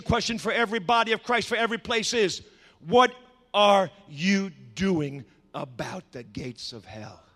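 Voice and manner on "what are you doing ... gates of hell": word by word, sinking low; slow, weighty challenge. Real, not acted. Preacher challenging the church on the gates, slow and grave. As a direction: space out the question, dropping to a hush.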